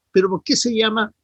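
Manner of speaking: ordinary talk, nothing marked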